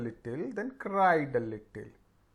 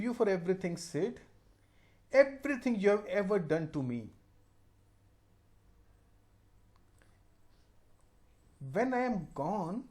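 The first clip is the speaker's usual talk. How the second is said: slow, feeble deathbed voice; hammed-up dying farewell; fake dying boy; much slower than usual. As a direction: forgive everyone as if on your last breath. Tom acting forgiving, dying.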